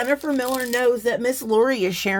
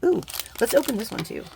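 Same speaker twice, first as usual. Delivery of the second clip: ordinary talk, nothing marked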